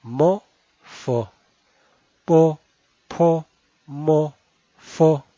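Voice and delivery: po mo fo said crisply; Mandarin initials. Shown as a demonstration. Teacher repeats po mo fo for learners. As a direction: drill po mo fo. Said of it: "así lo pronuncian".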